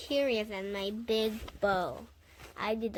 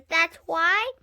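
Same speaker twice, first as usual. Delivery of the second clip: ordinary delivery, nothing marked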